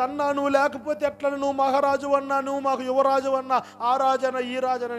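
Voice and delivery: high, chanted mock lament; mock wailing. Younger brother lamenting at a funeral, imitated high wail. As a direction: imitate a showy mourner, pitched high, without shouting. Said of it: "ఈయన అరిసే అరుపుకి".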